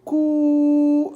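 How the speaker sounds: "Cou" high, with a very long vowel; held syllable. Shown as a demonstration. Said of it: "je commence par la première". (cou aigu et très allongé)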